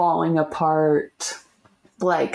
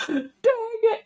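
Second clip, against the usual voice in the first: mock crying.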